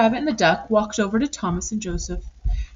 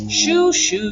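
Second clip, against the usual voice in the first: high, louder shoo; 7 semitones higher, 4 dB louder than usual. Acted. The chickens chasing the pigs away, high. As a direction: wave them off.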